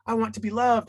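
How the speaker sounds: put-on be the small kid begging for love; little child. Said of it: "So you're this little child, and you're like".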